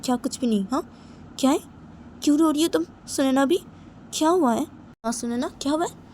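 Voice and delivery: high, loud worried questioning; agitated, demanding to know; insistent concern. Acted. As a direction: press her for an answer, pitch jumping up. Friend presses her crying companion.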